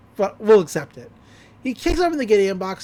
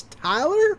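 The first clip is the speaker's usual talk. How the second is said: pitched-up surprised query; high questioning call; 10 semitones higher than usual. Retold or imitated. Deputy calling out to Tyler, pitched high. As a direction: call his name up high.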